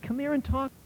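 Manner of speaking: high, bright-voiced old man beckoning; old man calling. A narrator's reading. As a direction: beckon him over gently with delight. Old man beaming, calling drunk.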